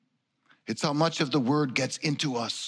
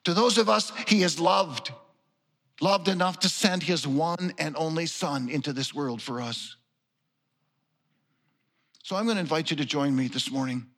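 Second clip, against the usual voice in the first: ordinary talk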